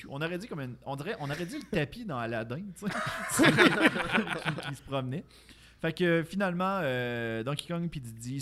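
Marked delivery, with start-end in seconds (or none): 2.8-5.2 laughing. Real, not acted. Laughing while describing the carpet.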